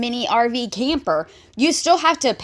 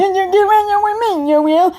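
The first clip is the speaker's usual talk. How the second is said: high, loud sing-song mimicry; mocking imitation; 9 semitones higher, 3 dB louder than usual. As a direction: parrot the words back in a high mocking voice. Mocks the clip just played.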